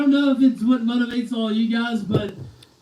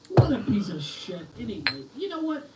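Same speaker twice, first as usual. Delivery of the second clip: ordinary talk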